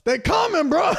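high hammed-up warning yell, breaking into laughter; mock yell. Paul Revere to townspeople, mock yelling a warning. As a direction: yell a frantic warning, badly faked, half laughing. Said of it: "went to the town and just yelled".

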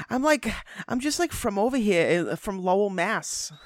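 high, put-on Massachusetts accent. Imitating an actor claiming Lowell roots, mock Massachusetts accent. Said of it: "that one he picked".

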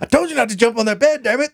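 hammed-up furious parent; mock angry parent. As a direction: yell at the kid jumping on the bed. Parent yelling at a kid.

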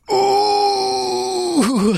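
high hammed-up yelp of pain; overacted high-pitched "Ooh!" cry; Richard Tyson's yelp. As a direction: mimic a cheesy movie cry, way up high. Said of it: "Richard Tyson just goes".

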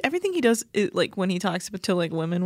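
ordinary delivery

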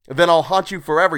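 high, loud vow; angry threat. A narrator's reading. Man swearing to haunt his killer, angry. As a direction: hurl the threat at the captor.

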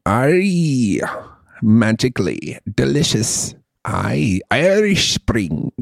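put-on jaunty Irish accent with drawn-out vowels; Irish brogue. Podcast host trying out an Irish brogue for his co-host, Irish accent. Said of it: "do a fucking good Irish brogue".

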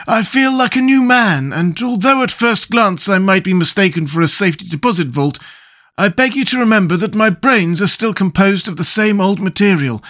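grateful Scarecrow. Acted. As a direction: speak with renewed pride.

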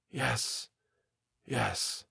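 very soft, fearful murmur; hushed reply. A narrator's reading. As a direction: answer barely aloud. Frightened answer to whispered warning.

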